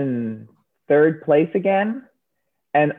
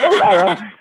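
laughing; 3 semitones higher, much faster than usual. Real, not acted. Echoing the joke, laughing.

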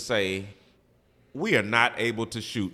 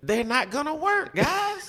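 high mocking voice; 13 semitones higher than usual. Mocking the spray-painted pistol.

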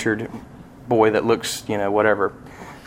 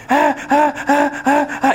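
high, slow mock baby cries; imitated toddler wails; 14 semitones higher, slower than usual. Dad imitating his son crying on the table. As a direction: wail like a scared toddler.

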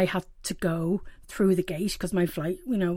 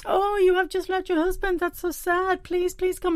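higher, caring voice for the kind security woman; sympathetic woman; 10 semitones higher than usual. Retold or imitated. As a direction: play the fussing helper who pities her. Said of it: "This woman puts her arm around me".

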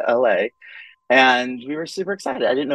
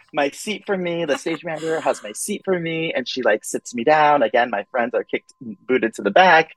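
ordinary talk

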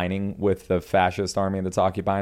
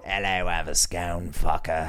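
slow, badly faked British accent; mock an Englishman, drawing it out; slower than usual. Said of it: "every accent that would just happen here was bad".

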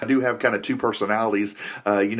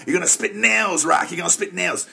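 higher, pumped-up trainer pep; gruff trainer; 7 semitones higher than usual. Retold or imitated. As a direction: fire up your boxer like a coach. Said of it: "I'm kinda that trainer guy in there".

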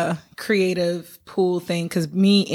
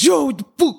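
gruff put-on man voice, louder; 7 semitones higher, 4 dB louder, faster than usual. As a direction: bark it like a tough guy. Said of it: "Or my man voice".

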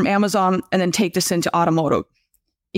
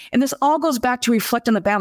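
ordinary talk

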